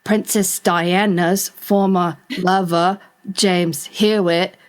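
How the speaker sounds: slow, stilted text-to-speech delivery; computer-generated voice. Retold or imitated. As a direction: read it word by word like a computer. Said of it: "sounded like a computer-generated voice".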